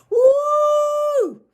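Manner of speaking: very high, loud cheering whoop; high kid whoop. A narrator's reading. Little boy cheers about pizza, high loud whoop. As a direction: whoop with pure glee.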